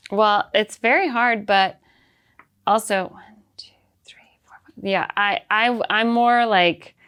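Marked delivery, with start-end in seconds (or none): 3.1-4.8 counting under her breath, much softer; whispered counting. Real, not acted. Comedian counting quietly while talking to the host. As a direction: whisper the numbers to yourself.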